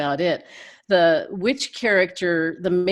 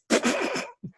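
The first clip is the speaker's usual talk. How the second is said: a high cackling witch laugh, a short burst; witch cackle; 13 semitones higher than usual. Shown as a demonstration. Showing the interviewers her witch voice with a quick high cackle. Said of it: "I can do a witch voice".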